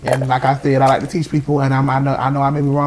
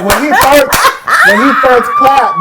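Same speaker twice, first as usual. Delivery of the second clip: teasing amusement; 9 semitones higher, 6 dB louder, slower than usual. Real, not acted. Teasing coworker, slow and loud.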